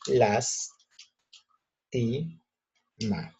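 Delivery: LAS, TI, MA with long pauses between; LAS-TI-MA in three isolated beats; syllable by syllable. Shown as a demonstration. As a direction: sound out the word slowly as las... ti... ma. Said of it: "por ejemplo, digo".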